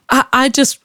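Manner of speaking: ordinary delivery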